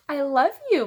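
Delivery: butter mom up; sweet-talking child. A narrator's reading. Fudge sweet-talking angry mom.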